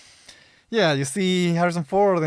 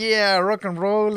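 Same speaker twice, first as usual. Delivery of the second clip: slow hammed-up teenager cheer, higher and louder; mock fifties teen; 5 semitones higher, 5 dB louder, slower than usual. Friend retelling the movie, mock teen cheer. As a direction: ham up a cool fifties teen.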